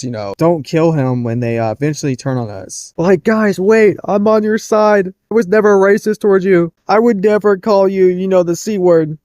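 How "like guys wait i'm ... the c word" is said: high, urgent mock plea to robots; worried pleading. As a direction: plead your innocence, mockingly high-pitched. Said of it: "how worried this guy is".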